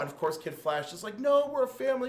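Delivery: high, desperate mock pleading. Kid Flash begging them to stay.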